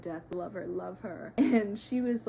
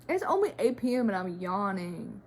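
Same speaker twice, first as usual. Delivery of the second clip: ordinary talk, nothing marked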